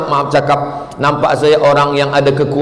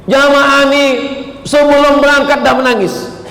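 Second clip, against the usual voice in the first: amused telling; 11 semitones higher than usual. Real, not acted. Telling about pilgrims crying early.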